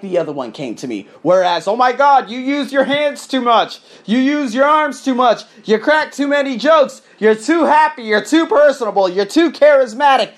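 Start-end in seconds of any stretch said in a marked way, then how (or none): 1.7-10.3 high, loud nagging list of complaints; mocking critics' voice. Mimicking critics who listed his faults, high and loud. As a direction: sneer out every fault like the critics did.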